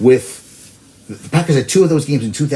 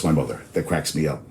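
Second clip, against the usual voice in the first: normal talking voice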